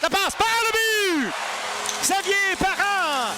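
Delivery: rising to a stretched goal call. Real, not acted. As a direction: build and shout the goal. Goal call in hockey play-by-play.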